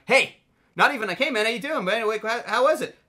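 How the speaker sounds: high, sing-song fake niceness; imitating the polite hello he never said; a hint of cheery hello; mock friendly greeting.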